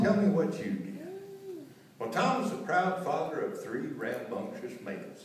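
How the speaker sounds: ordinary delivery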